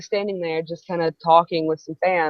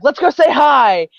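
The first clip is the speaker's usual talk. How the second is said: imitating an eager, louder, higher suggestion; brave eagerness; 6 semitones higher, 7 dB louder than usual. Friend getting brave to say hi, mock eager. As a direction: act out a burst of courage, drawn out.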